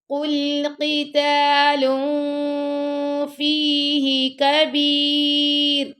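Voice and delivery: very slow melodic chant; Quran recitation. Shown as a demonstration. Teacher recites for pupils, slow melodic chant. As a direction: stretch the vowels in recitation. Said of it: "teacher demonstrates recitation".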